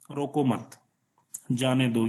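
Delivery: softly grouped रोको मत, break, falling जाने दो; pause after मत. Shown as a demonstration. Teacher answering what the meaning became, soft pause after मत. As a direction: say रोको मत quietly, pause, then release with जाने दो. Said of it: "फिर उसका मीनिंग क्या हो गया है?".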